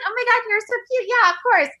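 high, fast mock gushing voice; gushing fake sweetness. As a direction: mimic a syrupy fake compliment, rushed and high. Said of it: "she's like".

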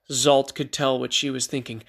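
ordinary delivery

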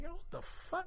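high, hushed shock. Retold or imitated. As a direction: gasp it out in disbelief. Shocked at blood on bus.

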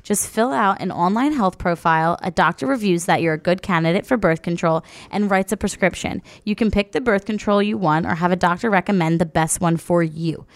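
upbeat sponsor read. Acted. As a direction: read it like a podcast ad. Host reads sponsor ad.

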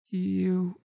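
put-on a sceptical young woman asking; young Wreath. Wreath hesitates.

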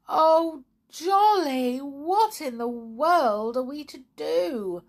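high, loud, slow wail of dismay; distressed cry. Acted. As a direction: cry out in despair. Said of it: "cried Tiptoe".

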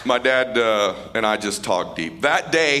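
usual speaking voice

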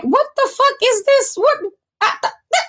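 high-pitched incredulous sputter; mock disbelief. Carl reacting to being accused, high mock disbelief. Retells Carl's baffled reaction.

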